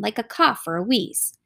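ordinary delivery, nothing marked

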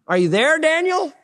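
high, worried call into the den; anxious call. Acted. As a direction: call down for Daniel, afraid. King calling into den.

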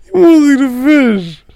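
high-pitched goofy voice; silly put-on voice. Teasing a friend about the fish, silly high voice. Playful repeats in odd voices.

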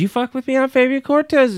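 high, strained threatening voice; mock Fabian snapping. A co-host voicing Fabian threatening her, high and strained. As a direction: squeal the threat like a cornered man. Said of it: "And he says".

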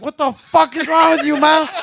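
high loud horrified yell; shocked yell at mom. Retold or imitated. Teen yelling at his mom mid-slaughter, horrified loud yell. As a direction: shout it at mom, appalled. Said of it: "I'm like".

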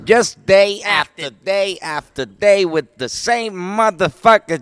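Chris Rock impression. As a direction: build into an exasperated stand-up rant. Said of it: "un poco de Chris Rock".

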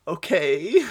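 high, loud rising okay; baffled disbelief; incredulous okay. Retold or imitated. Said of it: "and you're like".

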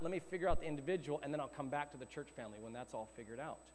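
quick, falling away softly; hurried imitation, dropping low and quiet; dismissive mock quote. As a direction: rattle off the wrong attitude as a throwaway. Voices the wrong mindset dismissively.

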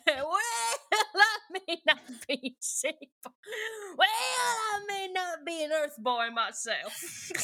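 put-on slow laughing Cajun country boy; Cajun drawl. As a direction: push the Cajun accent while giggling. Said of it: "he's a Cajun fella".

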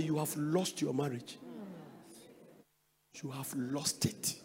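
grave quiet verdict. Retold or imitated. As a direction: deliver the verdict softly, weighing each word. Pronouncing a verdict on the marriage.

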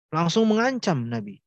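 normal talking voice

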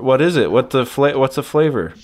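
ordinary talk, nothing marked

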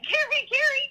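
high mock scream of a name; retold screaming. Mom screaming for her daughter in alarm. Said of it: "just a bunch of screaming".

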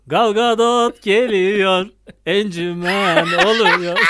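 slow, drawn-out loudspeaker call; campaign announcer. Pretend. Friend mocking a campaign minibus, slow announcer call. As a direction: stretch it like a campaign van announcement.

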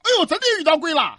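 high, fast, loud alarm; startled. A narrator's reading. Young man suddenly sees real ghosts, startled. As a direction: blurt it out in fright. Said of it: "小伙子吓一跳啊".

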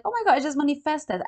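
high, loud, fast excited cry; retold joyful outburst, not quite a scream; excited squeal. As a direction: burst out thrilled. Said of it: "I start to scream".

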